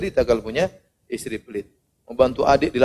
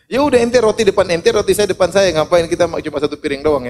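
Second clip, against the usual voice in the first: exasperated; 5 semitones higher, 5 dB louder than usual. Acted. Arguing with miser at meal.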